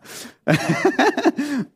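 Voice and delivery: a quick high laugh at his own joke; short laugh. Real, not acted. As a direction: laugh it off lightly.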